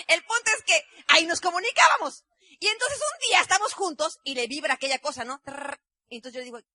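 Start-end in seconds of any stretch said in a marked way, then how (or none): 5.4-5.8 beeper buzz. Retold or imitated. Imitates beeper vibrating.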